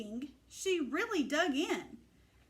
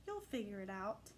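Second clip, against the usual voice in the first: soft, hushed goodnight whisper; 7 semitones lower, 11 dB quieter, faster than usual. A narrator's reading. Parents whispering goodnight to their sleeping daughter, hushed. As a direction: breathe it gently to a sleeping child. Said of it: "they kissed her and whispered".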